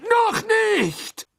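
very high, loud desperate cry; desperate scream. A narrator's reading. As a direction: scream in despair. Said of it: "schrie Karkaroff in heller Verzweiflung".

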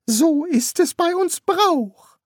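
very high, slow priest voice. Acted. Priest explaining custom to the young king, high and slow. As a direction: draw it out in a high put-on voice.

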